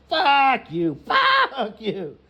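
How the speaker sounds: loud, drawn-out mock curse; angry yell. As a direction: let each FUCK burst out loud and high. Mimics cursing at man in way.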